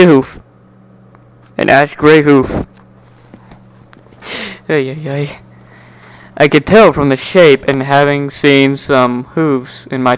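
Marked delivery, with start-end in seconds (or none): none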